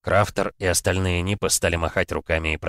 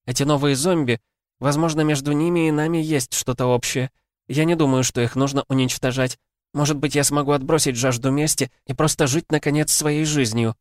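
put-on young woman voice; 8 semitones higher than usual. Huntress voiced, higher throughout.

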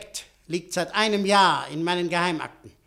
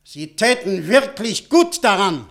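slow, louder, weighty threat; firm warning; 6 dB louder, slower than usual. Acted. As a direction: press the warning home, slowly and heavily.

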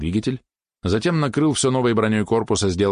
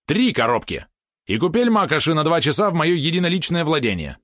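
high, excited demand; blurted out; 10 semitones higher than usual. A narrator's reading. Little dog names his bold price, high excited blurt. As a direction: blurt it before losing nerve. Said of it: "Выпалил пёсик, как будто сам настолько обалдел".

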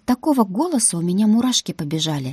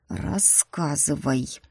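slow, low growl of a displeased dragon prince; 3 semitones lower, 5 dB quieter, much slower than usual. A narrator's reading. Dragon prince growls an order at the student. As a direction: drag out a menacing growl. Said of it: "прорычал принц".

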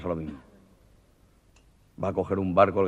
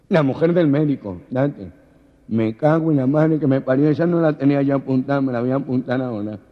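lightly voiced village man; 6 semitones higher, 10 dB louder than usual. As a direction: say it like an envious local. Ramonet reacts, loud.

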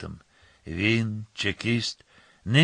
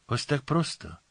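higher-pitched, mildly surprised; surprised question; 5 semitones higher than usual. A narrator's reading. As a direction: ask it with understated astonishment. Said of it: "здивувався Ліма". (злегка здивоване запитання)

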